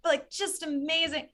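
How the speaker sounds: high, gushing traveller's excitement; gushing anticipation. Retold or imitated. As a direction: gush dreamily, pitched well up, easing off by the end. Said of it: "So I was like, "Oh, like".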